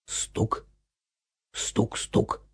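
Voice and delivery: soft knock knock; whispered knocking; knocking sound. A narrator's reading. Knocking on the door.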